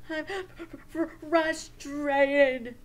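loud mock-frustrated stammer; acted frustration. Shown as a demonstration. Teacher recapping rule two, acted frustration. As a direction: struggle the word out in hammed-up frustration. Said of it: "name our feelings".

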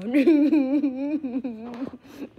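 big loud burst of laughter. Real, not acted. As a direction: crack up loudly.

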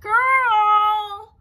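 huge high bellow; booming dragon roar of a name. Acted. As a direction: call out across the dark like a dragon. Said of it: "bellowed Dragon".

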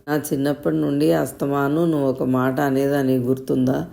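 ordinary delivery, nothing marked

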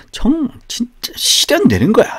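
high, stressed 진짜 실현되는 거야 with 진짜 pushed hard; emphatic paraphrase. Shown as a demonstration. Teacher modelling emphasis to students, stressed. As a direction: press hard on 진짜 to show emphasis. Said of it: "이렇게 강조하는 거".

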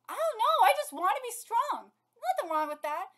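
high, loud protesting voice; earnest young character. Acted. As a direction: blurt it out as the character. Acts a character in the story.

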